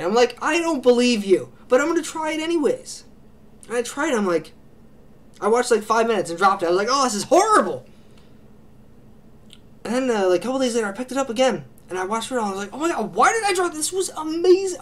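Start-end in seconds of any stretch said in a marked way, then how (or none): 0.4-3.0 higher-pitched retold disbelief; skeptical reply. Answering someone who recommended the series, skeptical and high. 6.9-7.9 high, loud retold dismay; mock disgust. Reviewer recalling dropping the show after five minutes, mock disgust. Retelling his first disgusted reaction. 12.7-14.8 high-pitched retold astonishment; amazed exclamation. Reviewer recalling watching it all after dropping it, high amazed gushing. Retelling his amazed change of mind.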